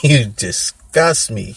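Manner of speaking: retold scorn, hammed up and stretched; emphatic disgust. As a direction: draw it out and hit DISGUST hard. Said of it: "I would just look at him like".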